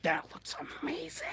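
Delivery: breathless hushed amazement; awed whisper. Real, not acted. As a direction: drop to a whisper in awe. Admires the painted back.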